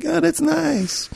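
high, slow, mild mock of the old mother; unimpressed old mother. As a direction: drag it out like an unbothered elderly mother. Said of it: "but it was like".

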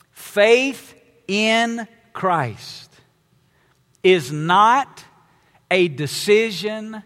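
slow key point. Acted. Preacher to congregation, stating the main point slowly. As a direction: deliver the main point slowly, pause between phrases.

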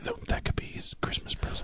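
secret whisper. Real, not acted. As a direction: hush it so the host won't hear. Secret from the absent host.

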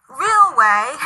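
"real way" set off in spoken quotation marks; air quotes. Shown as a demonstration. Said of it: "I'm doing air quotes if you can't tell".

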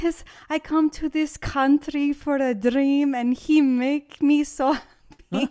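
mimicking a Mexican worker praising her boss; Mexican accent. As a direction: impersonate the worker's accented English. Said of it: "And she's like".

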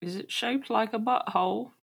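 high character voice asking. Acted. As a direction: ask it in your character's voice. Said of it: "don't use your character voice if you're asking".

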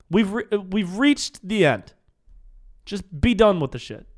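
high, exasperated outburst; exasperated rant. Real, not acted. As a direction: throw up your voice in loud disbelief. Exasperated rant about the fundraiser.

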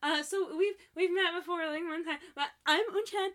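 high, anxious mock greeting that climbs to a yell; building to scream. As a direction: act out an awkward introduction, blurting the last part as a squeal. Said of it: "And she, like, screams".

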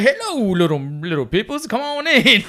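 exaggerated caricature of a welcoming TV star; Bill Cosby. Comedian mimicking star greeting him at trailer door, hammed-up Cosby voice. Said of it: "He was like".

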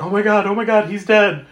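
a touch of hammed-up panic; mild panic. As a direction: gasp it out, mildly panicked. She freaks out after crash.

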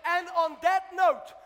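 loud, rising sermon shout; full-force preaching. Acted. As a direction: preach it out, roaring to the church.

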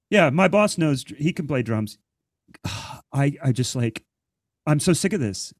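ordinary talk

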